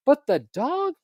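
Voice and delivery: high, disbelieving "But the dog?"; baffled. Retold or imitated. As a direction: ask it in total disbelief. Stepdad's baffled question, high pitch.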